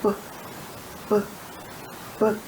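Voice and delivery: short popped b sounds; letter sound b. Shown as a demonstration. Teacher modelling the b sound for a child. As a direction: pop the lips for each b. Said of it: "she say".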